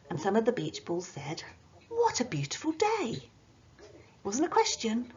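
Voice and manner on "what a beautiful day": high, delighted exclamation; exclaiming. Shown as a demonstration. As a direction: sing it out like a happy beach ball. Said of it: "it was an exclamation".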